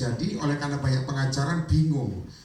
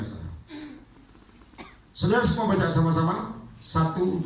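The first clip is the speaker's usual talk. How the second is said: ordinary talk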